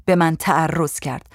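ordinary delivery

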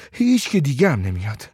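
ordinary delivery

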